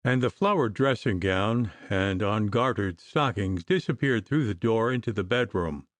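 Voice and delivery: usual speaking voice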